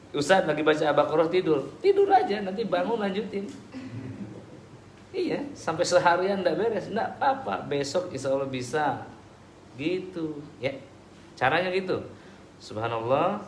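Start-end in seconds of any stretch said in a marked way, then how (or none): 0.1-7.6 mock devil's coaxing. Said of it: "devil urging sleep mid-reading".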